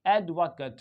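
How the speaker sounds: "एडवोकेट" as AED-vuh-kuht; noun pronunciation. Shown as a demonstration. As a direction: stress the first syllable of "एडवोकेट", short 'cut' ending. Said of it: "वो noun है".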